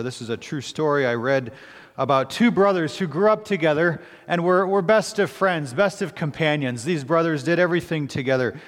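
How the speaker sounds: ordinary talk, nothing marked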